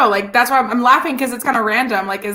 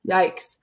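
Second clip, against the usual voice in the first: whispered sigh; 4 dB quieter than usual. Real, not acted. Reacting to tight standings.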